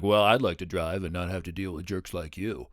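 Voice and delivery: cool, deadpan put-down in a put-on voice; lightly acted snarky retort; smug comeback. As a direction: deliver it like the clever reply you wish you'd said. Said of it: "I know I didn't actually sound like that".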